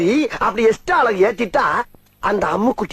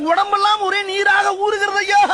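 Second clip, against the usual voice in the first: stagey shout; 8 semitones higher than usual. Acted. Dramatic classical Tamil line.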